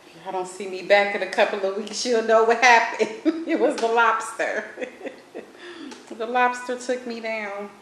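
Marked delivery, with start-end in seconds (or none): 2.1-7.7 laughing while joking, high and loud. Real, not acted. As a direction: giggle through the joke. Joking lobster took him down.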